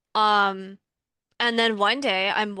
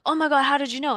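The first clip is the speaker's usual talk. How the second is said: higher, a bit louder mock astonishment; amazed; 5 semitones higher, 4 dB louder, much faster than usual. Stranger amazed that the speaker guessed right, mild mock astonishment. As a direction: gasp it out, lightly imitating her shock.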